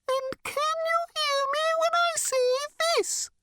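squeaky, very high bird voice. A narrator's reading. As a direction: pitch it way up, thin and high. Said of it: "It spoke this time at a much higher pitch".

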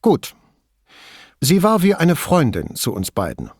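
terse middle-aged woman, wary; Signora Toso voice. A narrator's reading. Toso speaks.

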